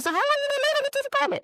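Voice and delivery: high and fast, disbelieving; incredulous question. Retold or imitated. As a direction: rush it out, shocked by the mess. Retold question to boyfriend.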